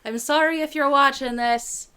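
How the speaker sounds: hammed-up, admittedly bad Irish accent. Shown as a demonstration. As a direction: put on a rough Irish accent on request. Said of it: "making you do an Irish accent".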